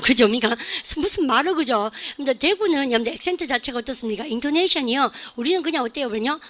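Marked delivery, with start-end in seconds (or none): none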